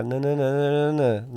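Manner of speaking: flat sing-song nonsense syllables; monotone la-la demo. Shown as a demonstration. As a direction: drone it like a voice without melody. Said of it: "se eu parar de falar minhas palavras".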